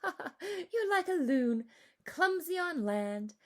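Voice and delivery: high, slow, teasing laugh-voice. A narrator's reading. Mama teasing Matisse about her clumsy dancing, laughing. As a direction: tease her fondly while laughing.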